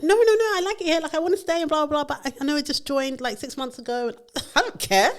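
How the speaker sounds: eager pleading. Retold or imitated. New hire begging her boss to keep her, eager and high. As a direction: gush that you want to stay. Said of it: "And she was just like".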